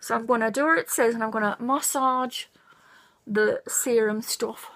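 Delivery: speaking while rubbing face; partly muffled by hands; hands over mouth. Real, not acted. Seen 0: Hands rub face, covering mouth at 0.2, 3.4–3.8, 5.8–6.2 seconds.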